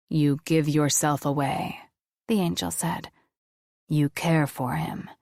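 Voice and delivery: solemn deep voice of an angel. Acted. Angel reads her.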